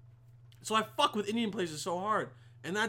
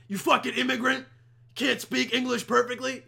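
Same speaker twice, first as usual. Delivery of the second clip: louder, higher mock insult; mock degrading jeer; 4 semitones higher, 6 dB louder than usual. Comic showing what he isn't doing, mock degrading jeer. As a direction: jeer it out in pretend contempt. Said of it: "degrading them and I'm like".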